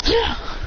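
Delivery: quick mock scream of someone killed; acted death cry. Acted cry before being killed.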